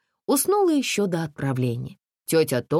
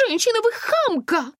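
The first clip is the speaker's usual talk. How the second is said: much higher, piercing shriek of a panicky lady; shrill lady's cry; 4 dB louder than usual. A narrator's reading. Panicky lady cries out in the dark bus. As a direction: screech it like a hysterical lady. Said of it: "опять завопила дамочка".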